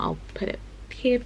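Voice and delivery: quick unvoiced whisper; whispered aside. Real, not acted. Telling viewers where the photo goes, whispered. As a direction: whisper it as a side note.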